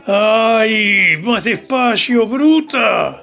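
wincing, drawn-out plea; pained complaint. Acted. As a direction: groan it out, hurting. Groaning during rough massage.

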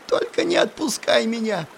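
high, breaking, frightened squeal. Acted. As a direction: let the voice crack to a squeal. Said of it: "Голос ... сорвался на виск".

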